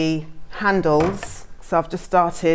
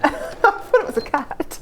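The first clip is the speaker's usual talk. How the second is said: giggling through the words; laughing; 10 semitones higher than usual. Real, not acted. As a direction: laugh while you say it. Laughs at a cat-like noise.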